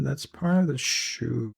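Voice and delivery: ordinary talk, nothing marked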